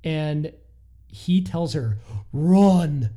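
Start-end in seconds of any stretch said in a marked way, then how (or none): 2.3-3.1 loud, mock-urgent order; urgent retold shout. Man tells her to run, urgent retold shout. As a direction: shout it like an acted warning.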